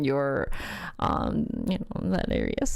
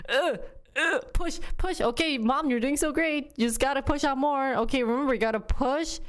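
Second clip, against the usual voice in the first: high, encouraging push coaching; cheerful nurse coaching; 6 semitones higher than usual. Retold or imitated. Mom imitating nurses coaching her to push, high and upbeat. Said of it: "it was just kind of like".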